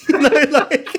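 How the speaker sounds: high, breathy laughter around the words; laughing. Real, not acted. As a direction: crack up while trying to finish. Host laughs about Bible version.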